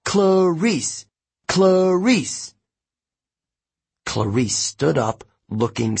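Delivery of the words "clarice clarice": high, drawn-out crowd chant; rallying supporters' call; cheering. A narrator's reading. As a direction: cheer her name like a whole cabin. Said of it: "started stomping and cheering".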